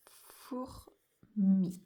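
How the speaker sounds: FOURMI in two slow beats, four, mi; syllable by syllable. Shown as a demonstration. Teacher recapping the two syllables, FOURMI slowly split. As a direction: leave a gap inside FOURMI. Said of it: "Donc, deux syllabes".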